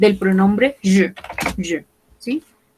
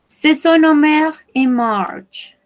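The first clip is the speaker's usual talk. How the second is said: slow model reading; 6 semitones higher, 3 dB louder, slower than usual. Shown as a demonstration. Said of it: "first model take, higher".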